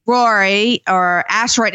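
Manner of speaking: "Rory" for Roy and "asteroid" with a slurred r, slow; "Rory or asteroid" said the wrong way, slowly; says Rory for Roy. Shown as a demonstration. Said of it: "I say things the wrong way".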